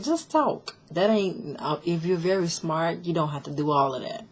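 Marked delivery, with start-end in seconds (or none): 0.0-0.7 exasperated snap. Retold or imitated. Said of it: "I'm like".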